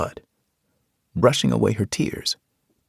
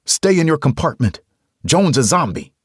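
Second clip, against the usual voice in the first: loud, raised warning; shouted warning; 9 semitones higher, 6 dB louder than usual. Acted. Man warning Carol through a closed hatch, shouting. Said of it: "I shouted through the closed hatch".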